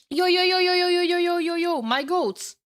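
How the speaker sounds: rapid-fire high hype chant; excited calling out. Real, not acted. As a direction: hail the fans in one excited run. Excited stream call to viewers.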